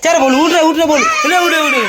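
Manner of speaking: high, loud laughing shouts; laughing command. Real, not acted. Telling Bolu to get up, high loud laughing shouts.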